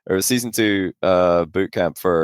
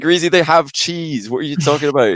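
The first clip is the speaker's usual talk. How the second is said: mock exasperated correction; 8 semitones higher, 4 dB louder than usual. Friends correcting Greasy.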